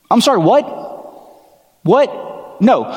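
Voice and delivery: mock disbelief. Preacher feigning shock at Jesus' delay, baffled. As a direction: play at being baffled.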